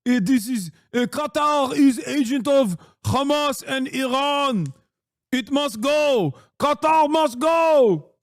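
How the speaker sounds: mock a Knesset hawk in a heavy Israeli accent. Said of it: "talking like a reactionary Israeli member of the Knesset".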